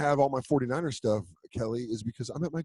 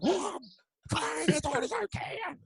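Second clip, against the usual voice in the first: slow, much higher whine; mock whiny self; slower than usual. Actor imitates his own whining answer to the trainer. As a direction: whine it out like a kid. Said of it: "And I'm like".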